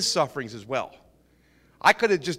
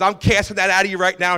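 louder, higher imitation of casting out; retold forceful command; 6 semitones higher, 7 dB louder than usual. Retelling stranger casting addiction out of partner, forceful. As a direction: imitate a zealous deliverance command, voice raised.

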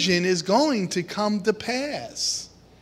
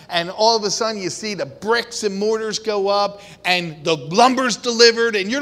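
usual speaking voice

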